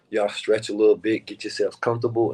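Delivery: ordinary delivery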